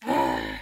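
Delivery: low, loud grunt of annoyance; exclaimed grunt. A narrator's reading. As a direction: grunt "ugh" deep in the throat. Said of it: "Badge exclaimed".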